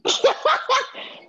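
nervous giggle. Real, not acted. Nervous laugh before spelling.

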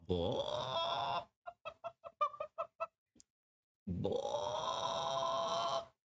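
drawn-out falsetto boah, way up high; wild boah yell. Pretend. Gamer in a sudden outburst, hammed-up falsetto boah. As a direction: let a silly howl burst out of you. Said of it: "es ist grad über mich gekommen".

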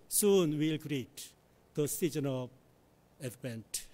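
normal talking voice

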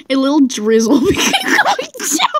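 giggling through the words; barely holding in laughter; laughing while reading. Real, not acted. Reading aloud, cracking up.

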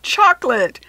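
loud, high happy exclaim; gleeful exclaim of delight; excited exclaim. Acted. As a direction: show off the tasty surprise. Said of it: "he exclaimed".

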